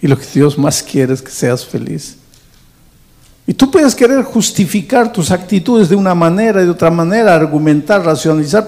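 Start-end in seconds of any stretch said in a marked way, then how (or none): 3.5-8.7 higher and louder preaching build; rising preaching. Acted. Pastor pressing the congregation about their excuses, fervent preaching.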